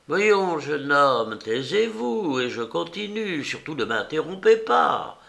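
put-on high, bossy old woman; scolding old woman. Old woman silencing the impatient young man, high and bossy. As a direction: shush the young man and carry on.

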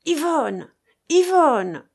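high, loud repeated cry. A narrator's reading. Keinec demands Yvonne from the dying man, crying out. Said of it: "s'écria Keinec".

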